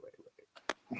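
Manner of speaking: urgent interruption. Real, not acted. Host halting the talk to back up, urgent interruption.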